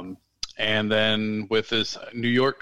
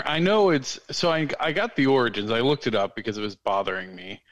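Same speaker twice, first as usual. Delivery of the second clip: ordinary delivery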